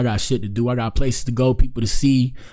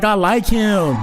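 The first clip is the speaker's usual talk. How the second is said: high-pitched teasing imitation; mock smitten woman; 8 semitones higher, much slower than usual. Host teasing woman in the clip, mock smitten. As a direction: mimic her crush in a high voice. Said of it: "she like".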